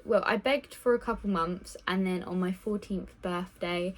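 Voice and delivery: usual speaking voice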